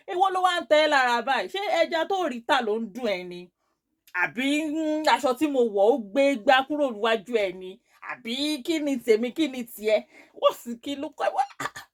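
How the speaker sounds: high-pitched cross retort; retold anger. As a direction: fire back angry questions, acting out the row. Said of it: "I was getting angry".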